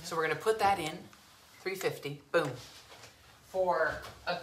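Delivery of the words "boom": normal talking voice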